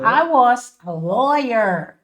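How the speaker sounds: very slow, evenly spaced English; slow model sentence. Shown as a demonstration. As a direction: say it slowly for learners to follow. Teacher models English sentence slowly.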